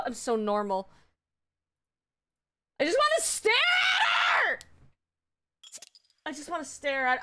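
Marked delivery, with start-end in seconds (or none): 2.8-4.6 very high squealed wail; overexcited scream. Real, not acted. Fan who just wants to stare at her, screaming. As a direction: squeal it out, stretching the end. Fan losing composure over friend.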